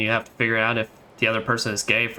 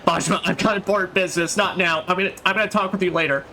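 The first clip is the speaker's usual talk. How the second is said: put-on higher, hurried girlish voice; flustered young woman; 7 semitones higher, faster than usual. Reina hurrying to escape Majima, higher and rushed. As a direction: beg to get away, rushing the words. Streamer voicing Reina's panicked plea.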